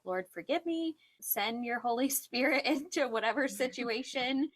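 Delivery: high-pitched retold plea to God; imitated urgent little prayer; pleading prayer. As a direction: re-enact begging God, half laughing. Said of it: "just having to ask God".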